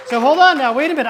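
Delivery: loud, high stop-everything; excited interruption. Real, not acted. Pastor pausing the story to check his notes, excited. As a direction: hold the room for a beat.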